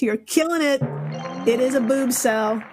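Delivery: ordinary talk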